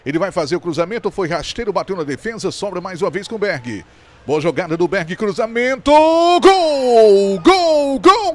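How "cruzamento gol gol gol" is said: long high goal cry; goal shout. Real, not acted. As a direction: scream the goal call. Goal call in live commentary.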